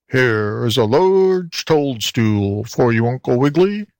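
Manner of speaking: slow, croaking toad voice; grunting toad. A narrator's reading. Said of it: "grunted Mister Croaker".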